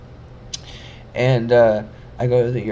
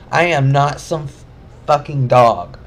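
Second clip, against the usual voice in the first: loud, furious retort; angry outburst; 3 semitones higher, 7 dB louder than usual. Retold or imitated. Telling the security guard off, furious and loud. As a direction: snap back at him, fed up.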